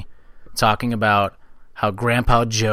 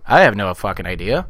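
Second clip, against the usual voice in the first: ordinary talk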